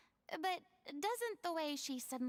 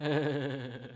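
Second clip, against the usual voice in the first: smug, self-satisfied precisely; 7 semitones higher, 5 dB louder than usual. Acted. Furina, smug to the group, self-satisfied. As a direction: savour being right.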